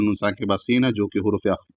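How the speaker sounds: usual speaking voice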